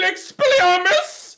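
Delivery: high, slow shouted spell. Pretend. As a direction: cast the spell loud and high like a wizard. Oh, he grabbed his wand.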